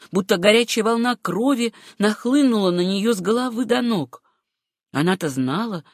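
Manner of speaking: plain reading voice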